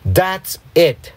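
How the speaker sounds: snapped. A narrator's reading. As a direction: cut them off crossly. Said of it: "he snapped".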